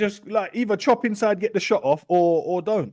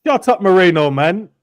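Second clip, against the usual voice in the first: exasperated shout; 5 semitones higher, 8 dB louder than usual. Real, not acted. Yelling at player on screen.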